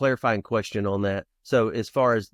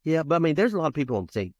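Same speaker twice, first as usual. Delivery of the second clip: retold kid's sales pitch; 6 semitones higher, faster than usual. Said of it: "I'm giving her the spiel".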